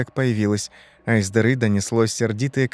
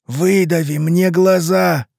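high, slow, drawn-out croak; hoarse begging; 7 semitones higher, 5 dB louder, slower than usual. A narrator's reading. Rotting man begs Ivan for release, hoarse pleading. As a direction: rasp out each word imploringly. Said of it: "повторил мужчина".

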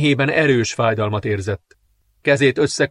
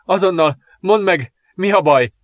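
high, urgent pained demand; gasping in pain; 7 semitones higher, 3 dB louder than usual. A narrator's reading. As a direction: insist on an answer through the pain. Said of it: "zihálva fájdalmában". (fájdalomtól zihálva, követelőzve)

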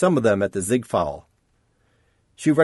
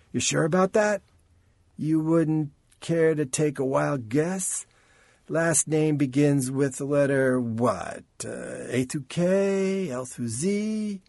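higher, slow needling questions; Chance, coaxing; 5 semitones higher, slower than usual. Acted. Chance coaxes Rain for a guess, slow and higher. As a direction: coax her along, slow and teasing.

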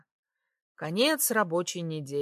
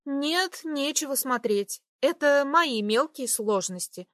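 higher, embarrassed refusal; refusing female voice; 7 semitones higher than usual. A narrator's reading. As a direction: brush it off, flustered. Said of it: "отказалась Ирина". (смущённый отказ женским голосом)

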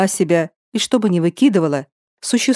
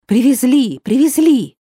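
raised, higher excited call; 5 semitones higher, 3 dB louder than usual. A narrator's reading. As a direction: call it out across the room. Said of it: "Кто-то закричал".

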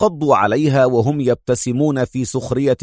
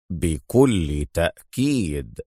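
much lower, softer and very slow; strange sinister tone; 7 semitones lower, 5 dB quieter, much slower than usual. Acted. The corrupt inspector answering the major, low and sinister. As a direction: purr it slowly and darkly. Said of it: "أجابها بلهجة عجيبة".

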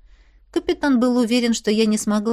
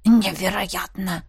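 slow, choked disbelief; strangled hiss; slower than usual. A narrator's reading. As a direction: hiss it through a tight throat. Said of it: "Капитан сдавленно зашипел". (сдавленным шипением)

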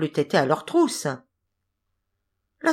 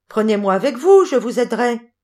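higher, louder eager call; 5 semitones higher, 7 dB louder than usual. A narrator's reading. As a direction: call up to the men, offering help. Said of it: "Tom leur cria de nouveau".

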